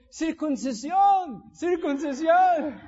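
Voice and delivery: high, loud, drawn-out triumphant cry; punchline shout. Acted. Rabbi answers while sawing.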